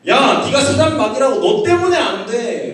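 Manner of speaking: loud, high, drawn-out accusing yell; furious shouting. Retold or imitated. God replays husband yelling at wife, furious shouting. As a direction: replay the outburst at full volume. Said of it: "라는 장면을 딱 보여주시는 거예요".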